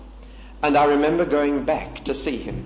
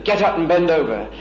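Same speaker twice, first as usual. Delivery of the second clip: headmaster's standing command; 3 semitones higher, 5 dB louder than usual. Retold or imitated. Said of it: "if he stood over and said to me standing".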